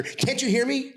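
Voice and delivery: high-pitched imitation of a manager; manager on walkie. Manager checking the walkie-talkie works, high-pitched mimicry. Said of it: "then you have the manager".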